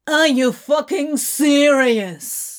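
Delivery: slow, loud exasperated shout upward; shouting up at the ceiling in a museum. Acted. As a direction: yell it in disbelief at the ceiling. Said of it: "Crowley shouts up at the ceiling".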